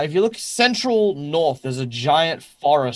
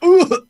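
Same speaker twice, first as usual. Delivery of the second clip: high, loud "ooh" of impact; winded grunt; 9 dB louder than usual. Acted. Player's character catches a heavy deer leg, winded grunt.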